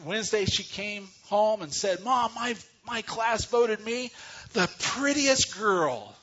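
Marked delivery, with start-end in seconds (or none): 2.0-6.1 put-on excited little girl. Daughter bragging to mom about prettiest vote, lightly excited. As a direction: draw it out like a beaming schoolgirl. Girl's excited quote in story.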